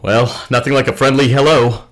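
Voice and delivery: ordinary talk, nothing marked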